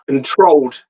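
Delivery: usual speaking voice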